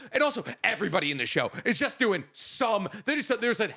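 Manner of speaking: normal talking voice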